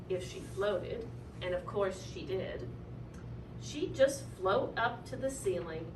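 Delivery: ordinary delivery, nothing marked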